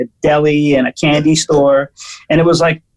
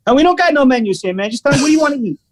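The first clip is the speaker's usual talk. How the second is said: higher mock gruff waiter, no patience; aggressive waiter; 8 semitones higher, much faster than usual. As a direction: bark that there is no menu, hammed-up. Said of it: "Really super aggressive".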